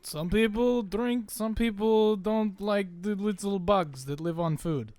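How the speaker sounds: put-on slow, loud Russian accent. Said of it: "Welcome to my Russian accent".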